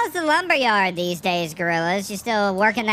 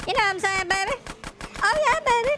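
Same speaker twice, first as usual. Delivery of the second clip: flirty falsetto; much faster than usual. Pretend. Flirty high put-on voice.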